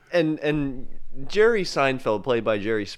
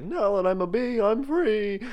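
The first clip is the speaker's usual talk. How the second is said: much higher gleeful proclamation; joyful cry; 10 semitones higher than usual. Pretend. Jerry flying with Queenie, a high gleeful cry. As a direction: sing out your freedom.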